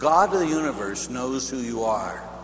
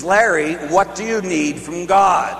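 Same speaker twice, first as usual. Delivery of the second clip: slower, pressing the name Larry; persistent repeat; 3 dB louder, slower than usual. Retold or imitated. The nun repeats her question to Larry, slower and pressing. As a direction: ask again, slower and more insistent. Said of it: "she was a persistent type nun".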